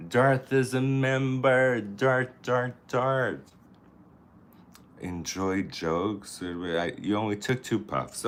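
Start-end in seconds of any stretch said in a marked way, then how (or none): 0.1-3.5 high, louder calls of "Darth"; high, louder greeting for a member; excited greeting. Real, not acted. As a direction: greet him with a cheer.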